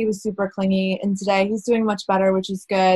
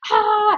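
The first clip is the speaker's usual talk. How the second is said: retold scream; 13 semitones higher, 3 dB louder than usual. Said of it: "I just kinda screamed".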